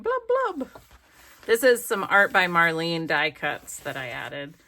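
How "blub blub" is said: high, slow cartoon bubble sounds; bubbling blub. Ladies sinking in the pool, high bubbly blubbing. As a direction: blow it like bubbles underwater. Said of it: "the two ladies jumping in the pool going".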